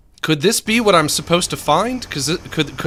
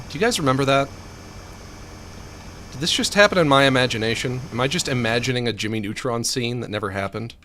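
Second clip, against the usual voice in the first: normal talking voice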